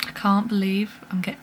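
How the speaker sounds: ordinary delivery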